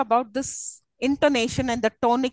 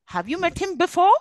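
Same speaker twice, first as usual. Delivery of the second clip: higher question with "before" lifted at the end; standout final word; 6 semitones higher, 3 dB louder than usual. Shown as a demonstration. Teacher asking what stands out, rising stress on BEFORE. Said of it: "what is it which is standing out".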